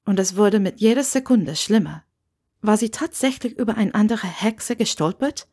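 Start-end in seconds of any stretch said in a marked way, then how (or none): none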